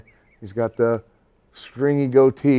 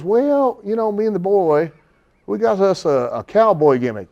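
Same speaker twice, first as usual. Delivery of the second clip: country promoter; 8 semitones higher, 5 dB louder than usual. Retold or imitated. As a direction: boast it like big news. Promoter voiced, much higher.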